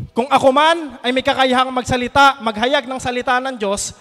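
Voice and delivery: loud preaching of the verse; scripture reading. Acted. Preacher reads verse two aloud, loud and proclaiming.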